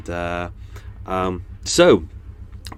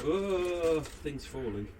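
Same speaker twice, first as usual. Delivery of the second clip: ordinary talk, nothing marked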